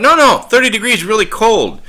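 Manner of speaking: insistent protest. Retold or imitated. Friend insists on phone.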